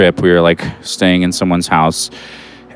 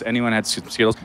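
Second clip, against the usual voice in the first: usual speaking voice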